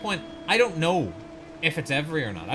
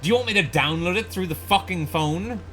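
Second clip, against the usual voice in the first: louder, higher exasperated rant at the courier; retold angry outburst; 5 semitones higher, 6 dB louder than usual. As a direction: snap at the delivery guy, fed up. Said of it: "And I'm like".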